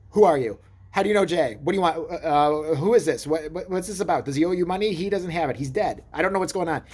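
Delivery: loud, high, pushy questions fired off; rapid interrogation. Retold or imitated. Grilling the Australian caller on the phone, loud and high. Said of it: "start interrogating the guy".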